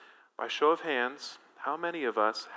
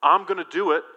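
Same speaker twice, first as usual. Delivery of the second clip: brash boast, higher and louder as it builds; overconfident Peter; 6 semitones higher, 8 dB louder than usual. Retold or imitated. Peter assuring Jesus he can handle it, cocky and loud. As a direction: puff up like you can take on anything. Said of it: "Peter gets ahead of himself".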